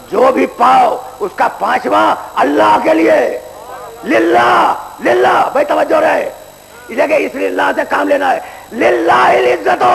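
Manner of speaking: full-force preaching. Acted. Preacher warns the young men about judgement day, in full-force preaching. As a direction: thunder it from the pulpit. Preacher hammering the word lillah.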